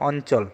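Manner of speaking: a plain dental-style n inside অঞ্চল; n sound shown. Shown as a demonstration. Teacher gives an example spelling, demonstrating the n in অঞ্চল. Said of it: "এখানে ন উচ্চারিত হয়".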